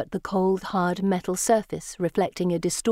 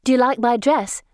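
eager; 5 semitones higher, 5 dB louder than usual. A narrator's reading. Anne showing off dress.

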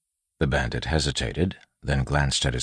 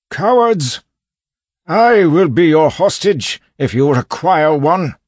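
put-on high, very loud old man's challenge; outraged bellow; 10 dB louder than usual. A narrator's reading. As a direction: yell defiantly at the gang. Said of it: "a familiar voice bellowed".

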